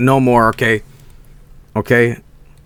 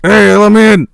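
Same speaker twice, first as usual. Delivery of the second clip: higher and much louder pretend shout; mock yell; 7 semitones higher, 8 dB louder than usual. Crackhead imagined yelling through the hotel glass, loud mock shout. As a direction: bang on the glass and yell to be let in. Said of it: "stand outside the glass and just go".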